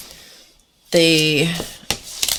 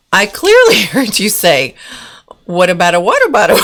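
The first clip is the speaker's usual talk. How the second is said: punchline swallowed by laughter; fast and cracking up; laughing through the punchline; 3 semitones higher, 5 dB louder than usual. Retold or imitated. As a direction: tries to quote the butler but giggles. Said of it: "I probably can't say it".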